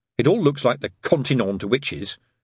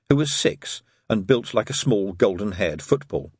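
ordinary delivery

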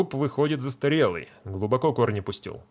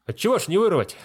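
breezy confidence; 9 semitones higher, 5 dB louder, faster than usual. Acted. Feldsher boasting to patient.

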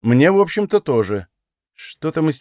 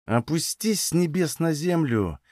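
slow, higher, contemptuous put-down; drawn-out venom through gritted teeth; seething through teeth; 5 semitones higher, 4 dB quieter, slower than usual. A narrator's reading. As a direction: squeeze each word out slowly. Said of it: "цедила она в ответ".

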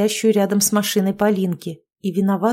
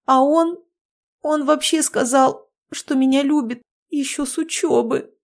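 sniffly; 6 semitones higher than usual. A narrator's reading. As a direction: tell it on the verge of tears. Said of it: "шмыгнула носом". (плаксиво, шмыгая носом)

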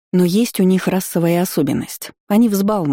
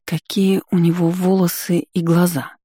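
plain reading voice

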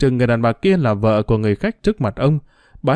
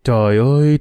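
ordinary delivery

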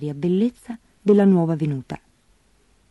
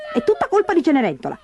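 quick, high-pitched complaint; litigious, petulant whine; whining stepsister; 11 semitones higher, faster than usual. A narrator's reading. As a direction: moan like a spoilt sister blaming someone. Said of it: "si lagnava Berta".

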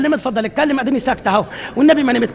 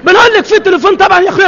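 loud, higher snapped yell; shouting; 6 semitones higher, 7 dB louder than usual. Acted. The woman yells at the neighbor about the phone, loud shout. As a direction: bark the answer at him. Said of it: "بتشخط ليه؟".

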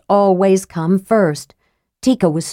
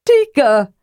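louder call after her; heartsick call; 8 semitones higher, 5 dB louder than usual. A narrator's reading. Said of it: "Karaman called, heartsick".

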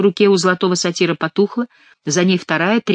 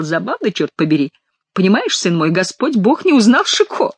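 ordinary delivery

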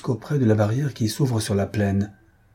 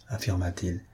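plain reading voice

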